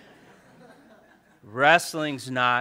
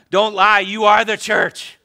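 louder and higher on don't lie; playful shout; 9 semitones higher, 13 dB louder than usual. Acted. Pastor teasing his church, playfully shouting. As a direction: call it out to the whole room.

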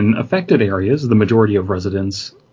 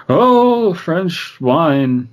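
slurred, pompous wine ad voice; hammy drunk Orson Welles; 8 semitones higher, much slower than usual. Retold or imitated.

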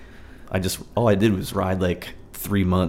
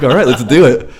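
mock eager agreement; 4 semitones higher, 11 dB louder than usual. Eager agreement in retold scene.

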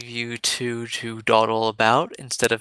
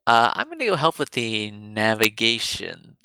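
ordinary talk, nothing marked

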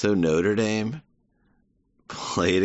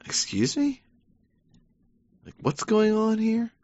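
much higher, incredulous Irish onlooker; bewildered local; 13 semitones higher than usual. Retold or imitated. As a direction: squeak out the disbelief. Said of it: "It's like".

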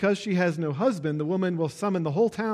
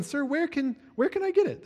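high excited question; eager woman; 11 semitones higher than usual. Acted. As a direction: voice jumps high, eager asking. Woman eagerly asking preacher's voicing.

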